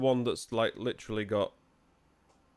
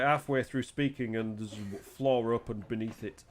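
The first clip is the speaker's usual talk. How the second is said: ordinary talk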